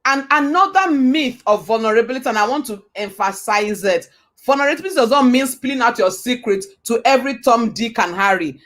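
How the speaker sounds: ordinary delivery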